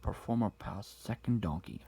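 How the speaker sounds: ordinary delivery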